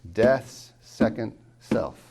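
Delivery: death's second self slowly, each word separated; stressed and lengthened. Shown as a demonstration. Teacher shows stress slowing the line, stressed and lengthened. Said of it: "It takes longer to say that".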